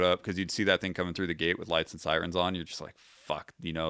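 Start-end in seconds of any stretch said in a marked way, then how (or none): 3.0-3.5 breathy, muted dread; a soft, sinking curse under the breath; whispered fuck. Retold or imitated. Seeing wounded arrive.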